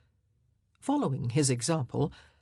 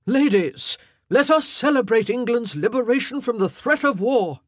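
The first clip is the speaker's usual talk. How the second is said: loud, high proclamation to the room; raised, ringing royal announcement; jubilant cry; 7 semitones higher, 8 dB louder than usual. Acted. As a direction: call out to the ladies in celebration. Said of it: "he cried".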